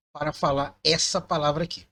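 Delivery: usual speaking voice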